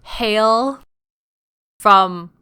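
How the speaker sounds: ordinary talk, nothing marked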